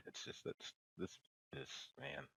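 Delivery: usual speaking voice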